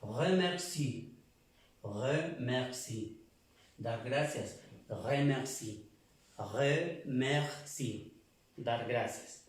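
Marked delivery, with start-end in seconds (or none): 0.0-3.2 slow French "Remercie" with a throaty French r. Shown as a demonstration. Teacher modelling "Remercie" for Spanish-speaking students, slow and careful. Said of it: "que traduce al, al francés". 4.9-8.1 slow French "Remercie" with the r rolled in the throat. Shown as a demonstration. Teacher repeating "Remercie" for students to copy, very slow. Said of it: "que traduce al, al francés".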